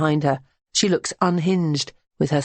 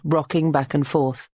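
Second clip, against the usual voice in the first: ordinary delivery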